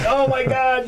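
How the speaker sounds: high, panicked oh my God; retold scream. Instructor watching the crash, high panicked cry. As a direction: cry out as the helicopter hits. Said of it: "I'm screaming".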